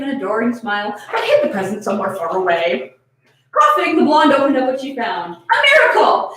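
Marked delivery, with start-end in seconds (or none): 5.3-6.3 much higher, louder exclamation; delighted surprise. A narrator's reading. Narrator on Haruka finding the polish: excited surprise. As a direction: burst out as if striking gold.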